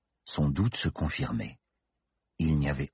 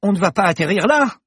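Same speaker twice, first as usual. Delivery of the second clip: high, loud panicked yell; yelled in fright; 9 dB louder than usual. Acted. Paloma yells at the pilot before landing, panicked. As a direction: scream the question in panic. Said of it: "hurla Paloma".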